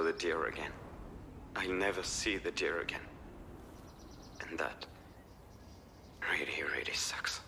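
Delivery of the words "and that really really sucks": sad whisper. Real, not acted. Grieving the deer.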